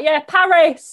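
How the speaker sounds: loud, high mock-British "PA-ris"; hammed-up British. Mocking how British people say it, loud hammed-up British. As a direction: belt out Paris with a British sounded s. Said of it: "That's how us British people pronounce stuff".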